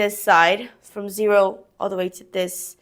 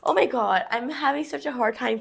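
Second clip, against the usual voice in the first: ordinary talk, nothing marked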